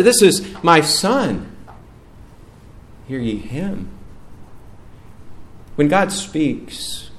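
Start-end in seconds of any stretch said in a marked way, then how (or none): 0.0-3.9 a hint of a divine pronouncement, settling down; voice of God. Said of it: "That's the heavenly Father".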